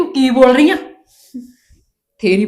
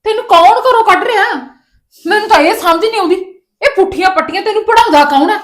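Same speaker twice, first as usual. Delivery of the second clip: much higher and louder, exasperated yelling; furious shout; 10 semitones higher, 6 dB louder than usual. Acted. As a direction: shout at her to make her understand. Mother shouts at defiant daughter. (ਗੁੱਸੇ ਵਿੱਚ ਚੀਕ ਕੇ)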